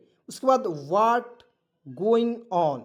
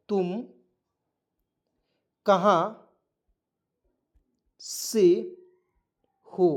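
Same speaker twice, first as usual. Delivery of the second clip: dictating slowly; much slower than usual. Shown as a demonstration. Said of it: "मैं लिख दे रहा हूँ".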